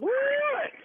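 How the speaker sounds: sudden very high squeal; surprised. Real, not acted. Victim learns it was a prank, surprised squeal. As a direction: caught off guard by the reveal.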